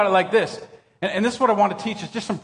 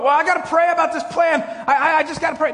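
higher, imitated fretting; mock earnest worrier; 9 semitones higher than usual. Churchgoer fretting over a plan, mock earnest. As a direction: act out someone anxiously insisting. Imitating people praying about plans.

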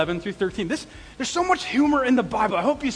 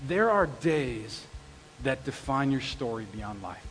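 hushed quote; 8 semitones lower, 8 dB quieter, slower than usual. Shown as a demonstration. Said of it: "a quote that she says in the movie".

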